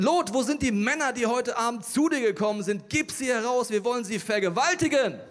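loud, high angry demand; mob roaring. A narrator's reading. Men of Sodom demand Lot's guests, roaring. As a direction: bellow it like a mob at the door. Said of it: "Sie brüllten".

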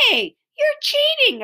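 high, protesting cry; objecting. A narrator's reading. Henry objects to the boy stealing the name, protesting. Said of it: "objected Henry".

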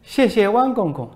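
high, put-on voice of a foreign reporter; mocking reporter voice. Foreign reporter needles the minister, high mocking voice. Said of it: "搓着手说".

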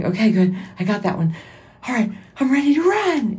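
high, bright eager talk to God. Retold or imitated. Podcaster retelling her eager answer to the Lord, high and keen. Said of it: "I'm like".

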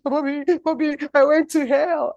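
wife waking husband after a nightmare, frantic yell; panicked yelling. Retold or imitated. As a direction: shake the husband awake in terror.

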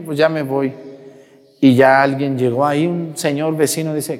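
ordinary talk

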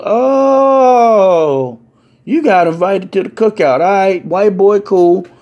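high, loud, long "oh" into a welcome; uncle's loud approval. Retold or imitated. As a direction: whoop it, then welcome him loudly. Uncle loudly welcomes guest to cookout.

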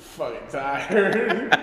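put-on high, slow, drawn-out drunk complaint; drunk whine. Retelling being too drunk to carry him. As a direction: whine it out, half laughing. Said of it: "I'm like".

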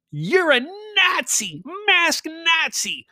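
high, slow mocking screech; mocking shrill woman. Mimicking the woman who calls him a Nazi, high and slow. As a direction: parody her shrill accusation. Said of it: "what's coming out of her mouth hole".

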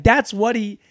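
ordinary talk, nothing marked

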